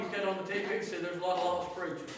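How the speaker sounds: usual speaking voice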